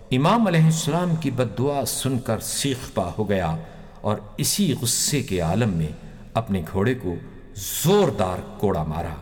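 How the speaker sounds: ordinary delivery, nothing marked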